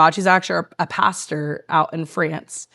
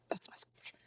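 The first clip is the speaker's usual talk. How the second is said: barely voiced whisper; very soft, voice nearly gone; hushed; 24 dB quieter than usual. Real, not acted. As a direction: let it fade into a whisper. Tearful end of her testimony.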